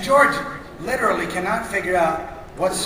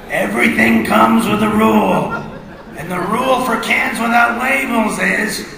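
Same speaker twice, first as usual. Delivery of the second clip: blustery cartoon dad; 7 dB louder than usual. Pompous father laying down the rule to the kids, booming.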